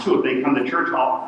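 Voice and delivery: normal talking voice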